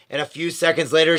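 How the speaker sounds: plain reading voice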